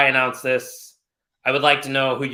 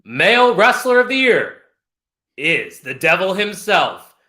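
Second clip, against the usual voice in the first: high, projected winner announcement; grand ring-announcer reveal; 4 semitones higher than usual. Acted. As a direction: start high and big, then stretch out the winner's name. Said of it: "let's get into my announcer voice".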